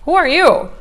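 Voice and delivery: high-pitched scoffing brush-off; dismissive scoff. Retold or imitated. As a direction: wave off a stranger's insult. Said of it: "I'm gonna be like".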